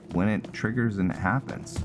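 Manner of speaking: ordinary talk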